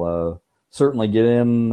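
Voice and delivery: normal talking voice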